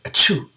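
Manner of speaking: big hammed-up dragon sneeze; loud sneeze. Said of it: "Every time the gray green, green dragon sneezed".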